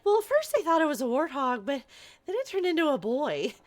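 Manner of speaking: a hint of an excited teen girl; lightly voiced cave girl, eager; light Eep voice. Quoting the movie, she gives Eep a light, eager voice. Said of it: "where Eep is like".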